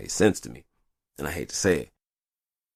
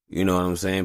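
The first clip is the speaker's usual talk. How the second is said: normal talking voice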